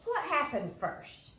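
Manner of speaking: loud, high, slow demand; hollered. A narrator's reading. Imogene hollering at Mother, loud and demanding. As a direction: holler it across the room. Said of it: "Imogene hollered at my mother".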